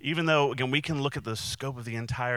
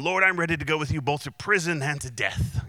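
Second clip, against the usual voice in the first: lightly acted pledge, raised and louder; a louder, higher mock vow; Peter's bold declaration; 6 semitones higher, 5 dB louder than usual. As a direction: declare it like an eager, devoted follower. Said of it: "this is Peter. He says".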